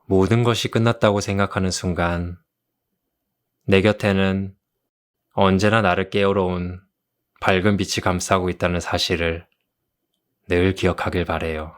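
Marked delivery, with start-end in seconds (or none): none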